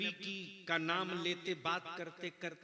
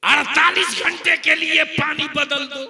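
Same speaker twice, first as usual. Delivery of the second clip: shouted high fiery sermon; full-force preaching; 12 semitones higher, 21 dB louder than usual. Real, not acted. Preacher shouting the punchline at the crowd. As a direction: preach it at the top of your voice.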